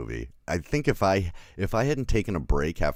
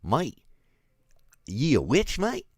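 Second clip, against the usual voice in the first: mock Australian accent, higher and louder; jokey Australian twang, raised and loud; 10 semitones higher, 5 dB louder, slower than usual. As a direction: ham up an Aussie actor in The Crucible. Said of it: "So were you like".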